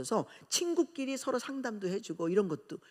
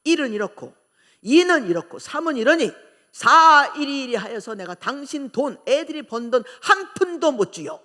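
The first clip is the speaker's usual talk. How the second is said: mother's defiant reply; 4 semitones higher, 13 dB louder than usual. Retold or imitated. Mother refusing her husband the children's money, loud and defiant. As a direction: stand up to him point by point. Mother defies father, listing reasons.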